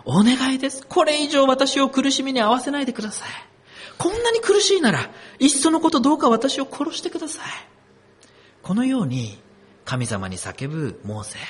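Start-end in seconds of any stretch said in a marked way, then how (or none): 0.0-7.5 high, pleading cry to God, lightly acted; Moses's anguished plea. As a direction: beg God as if at the breaking point. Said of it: "モーセは神様に叫んでいます". (追い詰められたモーセの悲痛な嘆願)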